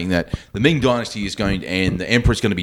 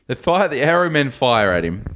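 normal talking voice

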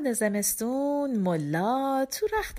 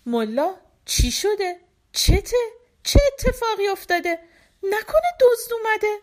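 rising, higher alarmed questions; startled from sleep, getting more worried; dazed and confused; 10 semitones higher, 5 dB louder than usual. A narrator's reading. Wife, just woken, asks Mulla what happened in dazed alarm. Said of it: "گیج و مبهوت".

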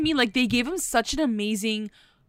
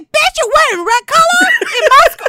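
very high, loud mock shriek; hammed-up mean-girl tirade; imitated pissed-off outburst; 10 dB louder than usual. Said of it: "like, pissed".

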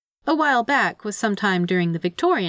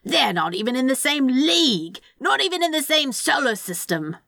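high, swooping outrage; theatrical indignation; 6 semitones higher than usual. A narrator's reading. Demon defending his Bentley, theatrically indignant. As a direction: protest with melodramatic offence. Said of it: "clearly unimpressed by the demon's theatrics".

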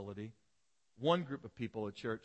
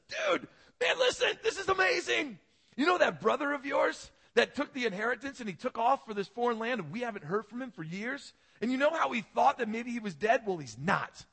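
much higher and louder, bursting with news; excited servant; 10 semitones higher, 7 dB louder than usual. Acted. Servant rushing to tell the older brother his brother's back, thrilled. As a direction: gush like a servant who can't hold it in.

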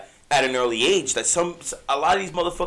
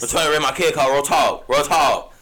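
mock Southern accent, pushed louder; hammed-up, clumsy Southern tough talk; bad Southern accent; 4 dB louder than usual. Parent warding off advice on kids, bad Southern accent. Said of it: "That is the worst Southern accent".

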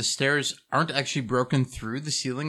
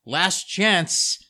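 raised, higher projected call; mock yell upward; 5 semitones higher, 6 dB louder, slower than usual. Player yelling up at a villain on the roof, playful raised call. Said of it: "like, yell up at her".

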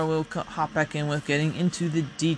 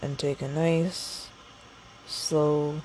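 guided meditation; slower than usual. Acted. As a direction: ease them into a slow breath. Starts a guided breathing break.

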